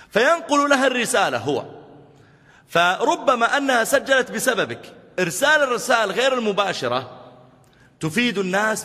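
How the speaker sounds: high, loud, with a laugh; loud lifted preaching, laughing on the way; loud excited preaching. Real, not acted. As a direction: push the lesson home with a chuckle. Preacher's emphatic point.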